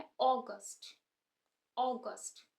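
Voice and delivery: August as AW-gust, long "o" first; stress on first syllable. Shown as a demonstration. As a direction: lean on the opening "aw" of August. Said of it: "stress starting में ही आ रहा है". (अगस्त में शुरू के ओ पर ज़ोर)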